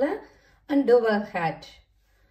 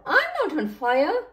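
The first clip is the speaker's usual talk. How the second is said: loud, raised-pitch protest; crying out; 6 semitones higher, 5 dB louder than usual. A narrator's reading. Soaked Edward tells Flynn off, crying out. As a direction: cry it out as a storybook objection. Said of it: "Edward cried out".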